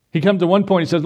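ordinary delivery

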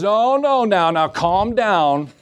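lightly voiced gentle hush, drawn out slowly; the Lord calming. The Lord hushing an overeager believer, lightly voiced and slow.

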